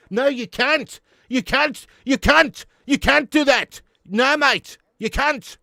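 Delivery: high, loud South African outburst; mock South African protest. Scolding chat after defending the accent, loud South African accent.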